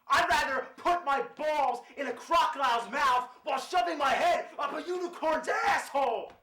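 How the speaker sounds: high shouted cartoon rant; raging gamer.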